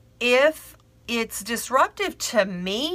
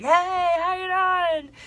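friendly yell; 10 semitones higher than usual. Retold or imitated. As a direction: holler hello to a neighbour across the way. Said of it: "a yell across the".

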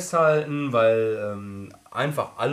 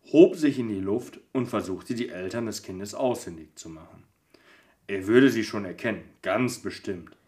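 calm storybook reading; steady, gentle storyteller reading; story narration. Acted. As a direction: read it like a bedtime story. Reads advent story aloud.

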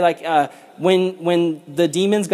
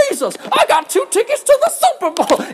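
much higher silly dubbed voice; squeaky overdub gag voice; overdubbed demon voice. Retold or imitated. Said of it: "they overdubbed his voice".

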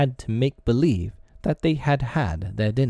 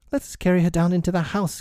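put-on high, quick boy's suggestion; suggesting boy; 8 semitones higher, faster than usual. A narrator's reading. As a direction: propose it eagerly in a higher voice. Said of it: "Curly suggested".

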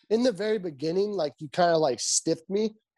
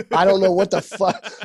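laughing; 5 dB louder than usual. Real, not acted. Laughing while ribbing his friend.

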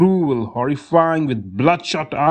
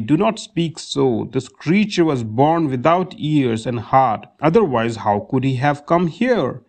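ordinary delivery, nothing marked

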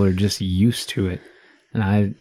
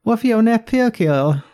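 squeaky put-on voice; 13 semitones higher than usual. Teasing question in put-on voice.

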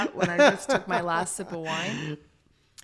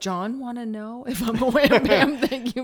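laughing; 4 semitones higher, 8 dB louder than usual. Real, not acted. Laughing while asking.

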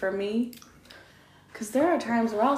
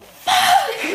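a higher, louder cry of fright; startled yell; 5 dB louder than usual. Retold or imitated. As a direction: blurt it when a bug crawls out. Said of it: "I was like".

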